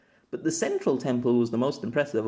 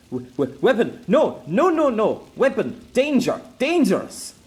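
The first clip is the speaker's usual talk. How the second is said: loud, much higher frantic broken warning; a terrified captive crying out in broken speech; desperate shouted warning; panicked prisoner; 10 semitones higher, 6 dB louder than usual. Acted. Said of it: "suddenly became lively again".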